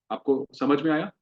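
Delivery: ordinary delivery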